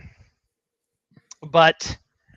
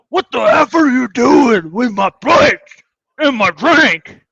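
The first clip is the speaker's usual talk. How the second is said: loud, high-pitched mock outrage; angry confrontation; 5 semitones higher, 6 dB louder, slower than usual. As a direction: imitate an irate man yelling over his plate. Retold angry man at table.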